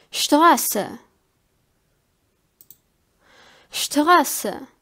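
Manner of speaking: "Straße" opening on sht, SHTRAH-suh; German ST as SHT. Shown as a demonstration. Teacher giving an example for learners, "Straße" with sht. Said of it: "we pronounce it like".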